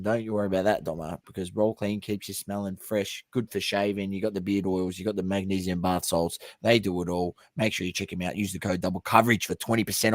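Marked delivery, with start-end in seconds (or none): none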